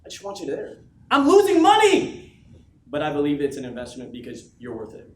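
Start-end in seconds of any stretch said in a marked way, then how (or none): 1.1-2.4 a slow, much higher, louder cry; shouted. Real, not acted. Speaker to his team, shouting about the cost. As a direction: belt it out in mock despair. Paying for team's travel.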